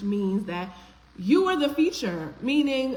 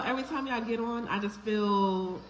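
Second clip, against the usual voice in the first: ordinary delivery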